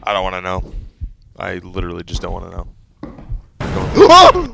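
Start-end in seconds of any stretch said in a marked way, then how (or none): 4.0-4.5 sudden high frightened yell; scared scream. Real, not acted. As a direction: cry out in terror.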